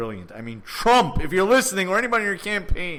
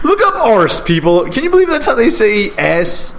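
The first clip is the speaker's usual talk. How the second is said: loud and raised, disbelieving; incredulous shout; 6 semitones higher, 10 dB louder than usual. Real, not acted. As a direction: call out to the listeners in disbelief. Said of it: "What an arse".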